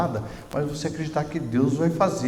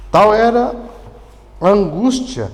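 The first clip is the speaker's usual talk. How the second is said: loud preaching burst; 6 semitones higher, 8 dB louder than usual. Acted.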